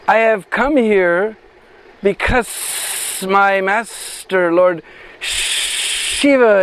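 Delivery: very slow, drawn-out serpent voice with long pauses; snake voice. Acted. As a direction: stretch every word like a great snake speaking. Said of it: "imitate the voice of that snake".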